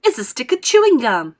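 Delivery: high, loud excited cry; excited yell. A narrator's reading. Gum-chewing girl to everyone, yelling with excitement. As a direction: yell it, thrilled to bits. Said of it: "a yell of excitement".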